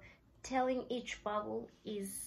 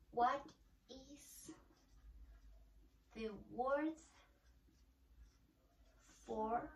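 each word split by long pauses, softer; slow, word by word; 7 dB quieter, slower than usual. Shown as a demonstration. Teacher modelling the question to students, slowly word by word. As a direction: spell it out one word at a time for learners. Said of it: "It's gonna be".

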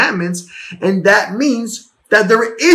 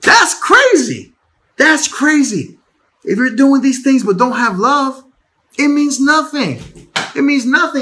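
exclaim; 6 semitones higher than usual. Real, not acted. Preacher marvels, repeats for emphasis.

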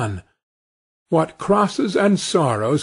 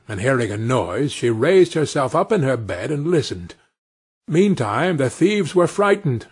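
usual speaking voice

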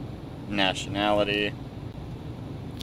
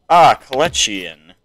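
ordinary talk

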